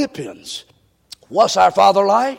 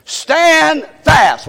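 louder, higher group call; 5 semitones higher, 4 dB louder than usual. Shown as a demonstration. Preacher leading the church in the phrase, called out. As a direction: lead the congregation in a shout. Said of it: "Let's say that together".

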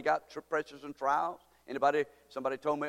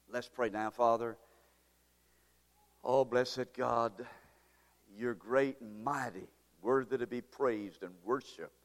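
prayer; 2 semitones lower than usual. Acted. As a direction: pray gently and humbly. Leads congregation in prayer.

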